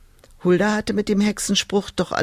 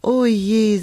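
sighing; 3 semitones higher, slower than usual. Acted. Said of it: "seufzte sie".

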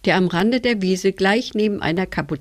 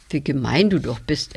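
plain reading voice

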